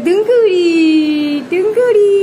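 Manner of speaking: loud, drawn-out teasing call; playful calling. Real, not acted. Adult teasing the laughing child, playful calling.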